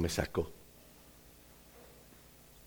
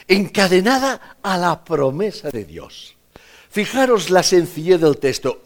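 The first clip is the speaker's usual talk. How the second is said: loud, high, impassioned preaching; fervent sermon delivery, higher and louder; full-force preaching; 8 semitones higher, 7 dB louder than usual. Acted. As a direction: proclaim it to the congregation. Preacher proclaiming God's promise.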